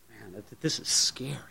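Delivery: breathless, frightened whisper; scared whisper. Retold or imitated. Boxer's opponent melting in fear, whispered.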